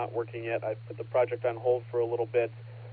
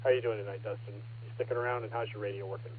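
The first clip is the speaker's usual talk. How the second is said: ordinary talk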